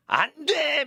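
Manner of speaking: high-pitched, forceful cry of prohibition; emphatic refusal. Acted. Preacher voicing the Bible's ban to the congregation, emphatic refusal. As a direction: call it out high as an absolute ban. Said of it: "이렇게 얘기하는 거예요".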